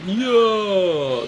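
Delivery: usual speaking voice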